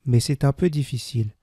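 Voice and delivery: usual speaking voice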